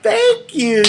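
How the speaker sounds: very high squeaky thank you; delighted squeal. Retold or imitated. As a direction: squeal it, flattered and tickled. Flattered reply to a fan.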